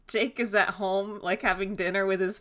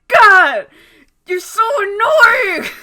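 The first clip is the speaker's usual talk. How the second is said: high, loud, slow yell with a laugh; mock outburst; 13 semitones higher, 16 dB louder, much slower than usual. [name] passes Adam and snaps at him, mock yelling. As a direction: snap at him in a hammed-up fit. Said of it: "he's like".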